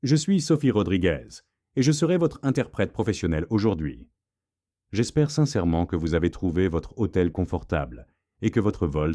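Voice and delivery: fluent, clear French; smooth, courteous French accent; flawless French. A narrator's reading. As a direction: introduce yourself in polished, formal French. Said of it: "she said in absolutely flawless French".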